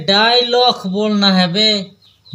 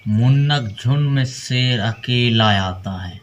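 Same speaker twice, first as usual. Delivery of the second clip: filmi dialogue; 6 semitones lower, 4 dB quieter than usual. Shown as a demonstration. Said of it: "dialogue he must repeat".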